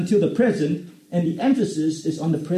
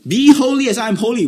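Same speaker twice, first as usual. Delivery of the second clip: full-force preaching; 6 semitones higher, 5 dB louder than usual. Acted. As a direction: thunder it from the pulpit. Preacher proclaiming scripture at full force.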